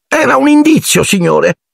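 high, squeaky elf explanation; Dobby, stating the obvious. A narrator's reading. Elf explains his clue to Harry, as if obvious. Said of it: "come se fosse la cosa più ovvia".

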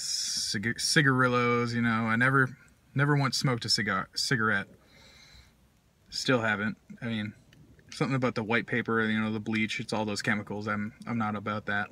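speaking with clenched pipe; talking around a pipe. Real, not acted. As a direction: pipe stem between teeth. Seen 5: Pipe stem held between lips throughout the labelled words.

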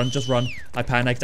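ordinary delivery

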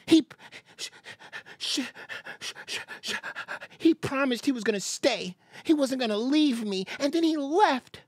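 a mock jilted ex-girlfriend; lightly voiced sulky ex-girlfriend; imitating her whining. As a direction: whine it like a bitter ex. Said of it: "that's how she sounds".

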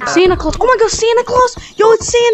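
excited. Real, not acted. Excited spotting Santa in game.

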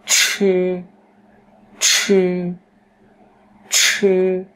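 "chư" with a burst of breath, high and level; tongue curled, puff air through "chư"; aspirated retroflex. Shown as a demonstration. Teacher drills breath release, "chư" strongly aspirated. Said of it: "lấy hơi vào nhá".

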